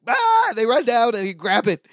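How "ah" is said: high, startled yelp; mock panic yelp. Co-host acting out the pair panicking as the piano tips, high yelp. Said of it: "they're like".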